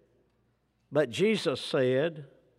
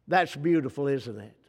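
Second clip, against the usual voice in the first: ordinary talk, nothing marked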